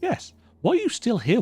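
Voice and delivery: normal talking voice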